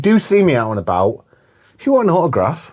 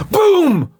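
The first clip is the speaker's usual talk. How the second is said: loud, high "boom"; explosive mimed punch sound; shouted punch noise; 3 dB louder than usual. Retold or imitated. Host acting out the hero hitting the guard, shouted.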